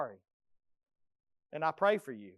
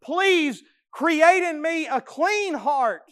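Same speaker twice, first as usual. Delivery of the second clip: slow, high, loud plea; pleading; 8 semitones higher, 8 dB louder, slower than usual. Acted. David pleads with God, slow and high. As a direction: beg for a clean heart. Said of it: "he pleads with God".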